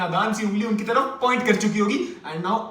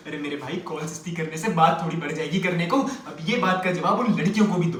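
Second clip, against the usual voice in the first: ordinary talk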